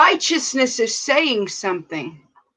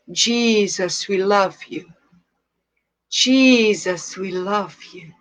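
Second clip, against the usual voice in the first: prayer; 3 semitones lower than usual. Real, not acted. Pastor praying to God at livestream start, hushed and tender. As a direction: pray tenderly, as if alone with God.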